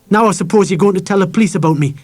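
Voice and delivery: put-on high, loud accusing boy; angry suspicion. A narrator's reading. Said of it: "He glared around the pillbox angrily".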